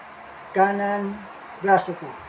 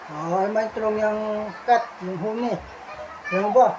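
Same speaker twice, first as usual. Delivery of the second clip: ordinary talk, nothing marked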